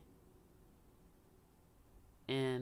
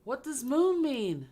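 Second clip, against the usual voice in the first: louder and higher, slow, fed up with the board; exasperated loud question; 9 semitones higher, 7 dB louder than usual. Real, not acted. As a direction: demand it in frustration. Frustrated at the board's answer.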